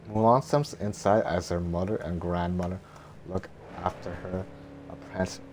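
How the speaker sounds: ordinary delivery, nothing marked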